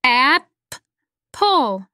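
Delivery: "Ap-, pull" as two separate beats with a long gap; split into syllables. Shown as a demonstration. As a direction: break apple into two beats, "Ap-" then "pull". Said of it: "the word apple has two beats or sounds".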